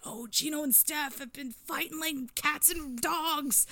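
high, drawn-out mock fretting; gossiping fans. Fan reporting that [name] and [name] have been fighting, mock alarm. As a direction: imitate fans passing on gossip, high-pitched and stretched out. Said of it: "anytime Deftones fans hear, like".

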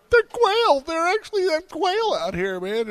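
freaking out. Retold or imitated. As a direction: exclaim in amazement at the quail. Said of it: "I'm going".

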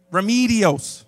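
slow "Remedios" as "ruh-MEE-dee-ohs"; "Remedios" slowly, no rolled R, long "MEE"; anglicized pronunciation. Shown as a demonstration. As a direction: drag out the wrong ee in "Remedios". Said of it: "people can't roll their R's".

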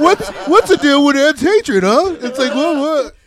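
loud, high, slow stand-up comic bit; milk it like an observational comic; hack comedian voice. Retold or imitated. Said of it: "such a funny hat comedian".

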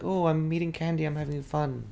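a quick hint of a silly movie lady gushing over candy; crazy movie woman. Retelling a movie scene to the cohost, lightly mimicking a giddy woman. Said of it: "that crazy woman in that t-, that movie, she's like".

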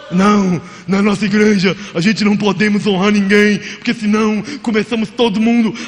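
hint of a Lula impression mocking stingy churches; Lula imitation. Preacher mimics a stingy church member, lightly Lula-like. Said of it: "parece o Lula".

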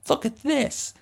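put-on high, proud kid voice; high eager voice; proud little kid. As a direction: show off the bill like a proud child. Proud kid showing the bill.

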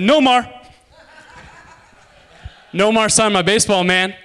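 put-on high, loud kid bursting with news; ecstatic boasting. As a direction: blurt it out, thrilled.

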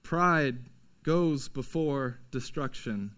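quiet, grave repetition of the proverb; hushed proverb. Shown as a demonstration. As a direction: let the warning sink in quietly. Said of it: "repeats the proverb quietly".

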